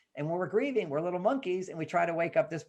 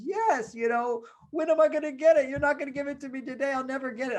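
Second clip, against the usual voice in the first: high-pitched mock despair; hammed-up fretful kid, pleading and protesting; whining child; 9 semitones higher, 4 dB louder than usual. Daughter fears never getting ice cream, whining.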